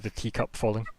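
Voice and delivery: ordinary talk, nothing marked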